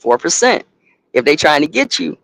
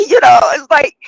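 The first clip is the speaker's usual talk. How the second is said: exasperated shout; 13 semitones higher, 5 dB louder than usual. Real, not acted. Laughing outburst about the stress.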